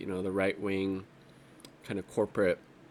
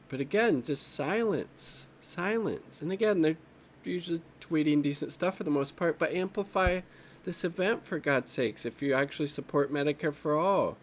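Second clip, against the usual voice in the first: higher-pitched, exasperated appeal; 7 semitones higher than usual. Real, not acted. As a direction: plead with frustration, pitch up throughout. Frustrated at allies' silence on event.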